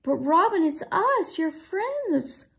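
high pleading cry. A narrator's reading. Camille appeals to the robin, crying out. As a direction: plead upward to a friend turned hostile. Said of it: "cried Camille".

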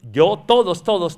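loud stressed "todos"; insistent loud. Real, not acted. Priest telling the congregation everyone is hurt, loudly. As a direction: drive home "todos" to the whole room.

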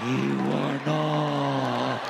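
low, stretched snore forming words; snoring exhale. Acted. Snorer taunts the one awake, snoring words. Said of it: "how do you people make these noises".

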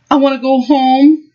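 put-on high, pleading child voice; a small boy who wants his mom; scared little boy.

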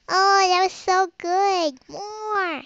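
put-on high, drawn-out baby whine; greedy baby begging in a high voice; little baby. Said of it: "And I'm a little baby".

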